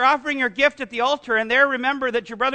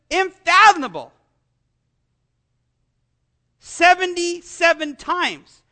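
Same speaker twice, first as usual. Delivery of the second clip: slow emphasis; 5 semitones higher, 4 dB louder, slower than usual. Real, not acted. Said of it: "slow emphatic delivery".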